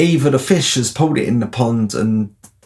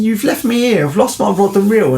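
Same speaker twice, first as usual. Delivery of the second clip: indignant; 9 semitones higher, 3 dB louder than usual. Retold or imitated. Indignant retold complaint.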